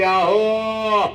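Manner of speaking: high, drawn-out call to the husband; wife calling husband. Acted. Wife calling her husband about his red eyes, long high call. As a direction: stretch the call out worried. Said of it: "तुझी आई म्हणते".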